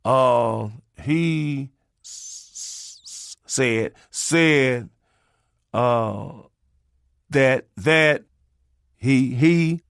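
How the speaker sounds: halting, repeating stutter on each start; stammering reader stuck on he and that; imitated stutter. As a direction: mimic a kid stumbling over the words. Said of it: "and you stutter".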